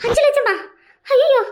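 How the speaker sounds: high, loud shocked gasp of a question; loud, high shocked outcry; shocked cry. Acted. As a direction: blurt it out in alarm. Mother shocked by the sum.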